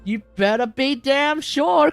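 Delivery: ordinary talk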